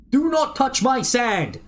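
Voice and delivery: high, slow, shrieking Do not touch my sand; mock outraged shout. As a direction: yell it like a scientist guarding his treasure. Reading a researcher's angry memo.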